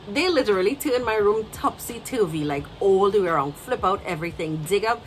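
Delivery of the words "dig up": normal talking voice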